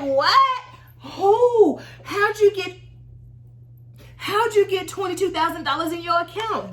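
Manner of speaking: high, loud disbelief; stunned by the balance; high loud astonished questions; shocked. Retold or imitated. Shocked at twenty-two thousand.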